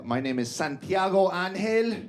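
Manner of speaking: "Santiago Angel" drawn out with a Spanish flourish; stank on it. Retold or imitated. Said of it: "I put a little stank on it".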